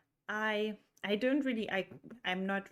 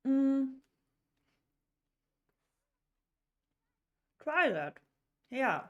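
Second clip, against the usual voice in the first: talking with mouth full; words pushed around a mouthful of food; 4 semitones higher, 4 dB louder than usual. Real, not acted. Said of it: "for speaking while my mouth is full".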